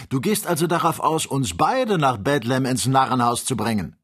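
hoch und laut, fassungslos; fassungslose Empörung. A narrator's reading. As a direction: den Freund für verrückt erklären. Kennedy entsetzt über die Einladung. (höher und lauter fassungslos)

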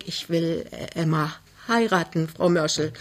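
soft, hesitant, halting; anxious. Acted. Young man tells girl's mother of marriage, anxiously. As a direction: falter timidly through the announcement. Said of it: "sagte er ängstlich".

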